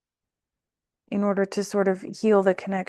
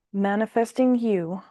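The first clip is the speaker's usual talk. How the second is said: ordinary talk, nothing marked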